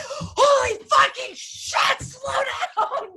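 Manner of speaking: high shrieking panic, slowed down; shrieking at her son to slow down; panicked shout. Retold or imitated. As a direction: yell at the driver in terror. Retells panicking at son driving.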